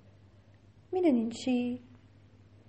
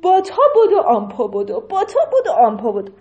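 excited chase; 10 dB louder than usual. A narrator's reading. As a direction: tell the chase like a game. Chase scene chant. (هیجان تعقیب و گریز)

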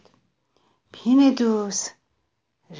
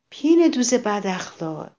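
ordinary delivery, nothing marked

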